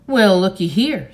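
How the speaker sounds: loud, folksy exclamation; blustering man. A narrator's reading. Man spotting the boy with a girl, blustering. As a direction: boom it out, pleased at the find. Bossy man greeting the children.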